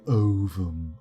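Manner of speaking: "ovum" leaned on heavily; odd emphasis on ovum. Real, not acted. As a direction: give "ovum" an exaggerated, misplaced emphasis. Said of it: "put that kind of emphasis on it".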